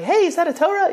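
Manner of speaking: a hint of a girl at the bar asking; mock girls' voice. Rabbi imitating girls at a bar asking Joey, mock high voice. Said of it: "Girls will be like".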